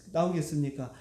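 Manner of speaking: ordinary delivery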